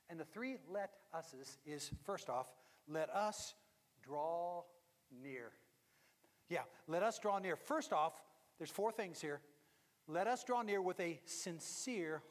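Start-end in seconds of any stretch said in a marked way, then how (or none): none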